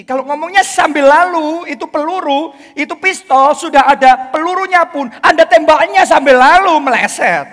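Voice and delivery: preaching. Real, not acted. Preacher building an image.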